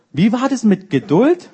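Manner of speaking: a touch of a divine reminder; Holy Spirit rebuke. Retold or imitated. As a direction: needle him about his patience. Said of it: "Ich so".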